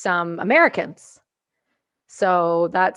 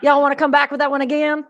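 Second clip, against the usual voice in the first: higher, louder hammed-up Southern radio voice; 6 semitones higher, 7 dB louder than usual. Texan soldier on the radio asking to repeat, mock Southern accent.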